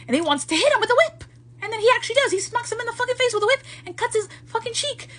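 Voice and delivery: high-pitched, agitated retelling; outraged storyteller, voice climbing high; worked up. Real, not acted. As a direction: get heated as you recount the attack. Worked up retelling a whip attack.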